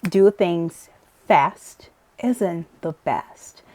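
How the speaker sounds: ordinary talk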